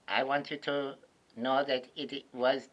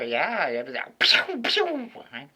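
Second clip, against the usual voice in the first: mock pew sound. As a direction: fire off a playful "pew pew". Imitating shooting sound effect.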